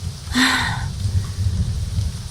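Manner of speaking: long weary sigh; a drawn-out sigh, letting the anger go; heavy sigh. Acted. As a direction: let out a long tired breath before the apology. Sigh before apologizing.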